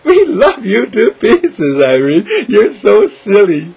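high, slow loving coo; doting affection. Acted. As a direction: coo fondly at a dear friend. Talking lovingly to Irene.

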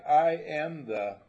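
a quiet, floating spoken affirmation; soft affirmation. Shown as a demonstration. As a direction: breathe it out like a gentle mantra. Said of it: "and you can say".

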